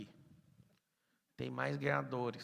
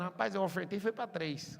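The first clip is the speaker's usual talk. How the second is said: higher, louder bragging quote; mock boast; 6 semitones higher, 6 dB louder than usual. Imitating a listener boasting after the talk, higher and louder. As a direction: crow about the offering. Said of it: "Você vai sair daqui falando".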